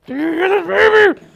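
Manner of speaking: high, loud put-on voice; mock gawker. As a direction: blurt it in a silly high-pitched voice. Mock voice of gawking diner.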